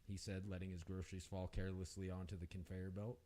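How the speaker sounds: usual speaking voice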